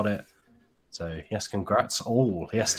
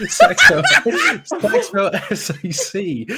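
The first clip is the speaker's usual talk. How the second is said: laughing; 6 dB louder than usual. Real, not acted. Laughing at a review.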